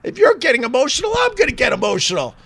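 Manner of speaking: mock tearful outburst; mock emotional. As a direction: pretend to well up, hammed-up. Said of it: "I'm like".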